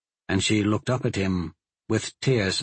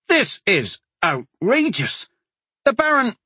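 outraged; 10 semitones higher than usual. Acted. Outraged father protests banishment.

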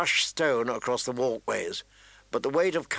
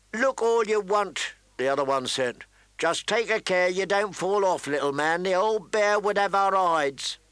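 mocking guard voice; 6 semitones higher than usual. A narrator's reading. Winchman mocking Tyrion.

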